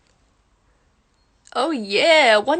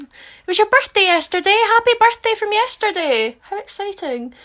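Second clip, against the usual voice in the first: high, loud, gushing birthday greeting; excited birthday wishes; 8 semitones higher, 7 dB louder than usual. Real, not acted. Streamer wishing a viewer happy birthday, excited squeal.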